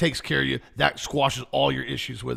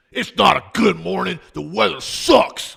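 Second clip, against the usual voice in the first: loud, higher-pitched grumpy snapping back; combative grouch; 6 semitones higher, 9 dB louder than usual. Retold or imitated. Mail carrier rejecting a good-morning greeting, combative. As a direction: act out a combative customer, loud and high. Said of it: "Everything was combative".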